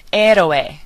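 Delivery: "Héroe" starting on the vowel, no H; silent H. Shown as a demonstration. Teacher repeating the word for learners, silent H in "Héroe". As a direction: say "Héroe" the Spanish way, "EH-ro-eh". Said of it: "we're not pronouncing the H".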